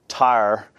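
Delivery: "tire" said as one syllable, "tar"; Tennessee pronunciation. Retold or imitated. Tennesseans giving sister-in-law a word to spell, "tire" pronounced "tar". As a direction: stretch "tire" into a Southern "tar". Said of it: "how you pronounce tire or tar".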